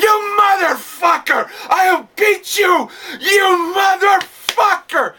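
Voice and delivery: slow, very high mock-furious shout; Eddie Guerrero enraged. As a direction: act out a red-faced temper flare-up, stretching every word. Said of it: "Eddie's temper would just flare up".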